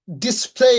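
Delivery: ডিসপ্লে as "dis-plei", rising; long EI ending. Shown as a demonstration. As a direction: glide up on the end of ডিসপ্লে. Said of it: "আমরা সাধারণত বলি".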